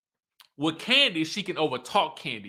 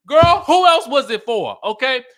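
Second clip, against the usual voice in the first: loud sassy retort; 7 semitones higher, 11 dB louder than usual. Real, not acted. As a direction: call it out loud, sure she is right. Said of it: "her own reply to Drew".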